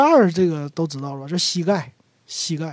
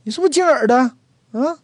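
accusing confrontation; 7 semitones higher, much faster than usual. Acted. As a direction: confront the guy who bumped you on purpose. Demo of the accusation.

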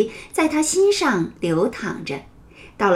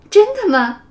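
surprised; 9 semitones higher, 4 dB louder than usual. Acted. Teacher surprised at child.

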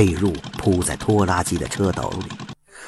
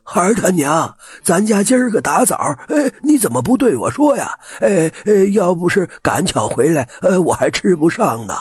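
high, whiny rambling complaint; a confused old man grumbling he wasn't told; dazed old man; 11 semitones higher, 5 dB louder than usual. A narrator's reading. (糊涂老头冲春枣抱怨)

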